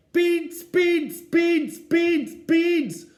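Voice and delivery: put-on high, loud, wailing toddler chant; toddler screaming. Little Eddie screaming for beans in the supermarket. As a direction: scream it like a furious little boy.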